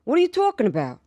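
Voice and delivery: imitating a thick New York accent. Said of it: "he talked his, the way that he talked".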